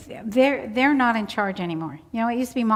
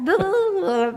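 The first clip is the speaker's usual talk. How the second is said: high wordless holler; imitated garbled babble; 10 semitones higher, 4 dB louder than usual. Storyteller imitating Eddie refusing pickles, garbled babble. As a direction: babble one long nonsense noise. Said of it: "So I knew that".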